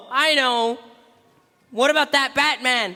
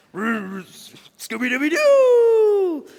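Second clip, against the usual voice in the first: Scooby-Doo howl; 3 semitones higher than usual. Retold or imitated. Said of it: "Shaggy voicing Scooby".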